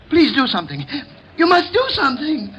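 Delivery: plea rising to a high, anguished demand; desperate pleading. Acted. Caretaker begging help for his unconscious wife, desperate. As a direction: beg frantically for help, pitch climbing.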